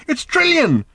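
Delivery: very high, loud cry; excited shout. A narrator's reading. Arthur shouts to Ford about the television, very high. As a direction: shout the name in astonishment. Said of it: "shouted Arthur".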